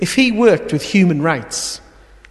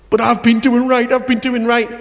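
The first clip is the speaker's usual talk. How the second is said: high, louder indignant son; whiny protest; 8 semitones higher, 3 dB louder than usual. Acted. Older son protesting to his father, higher and louder. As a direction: protest like a sulky older brother.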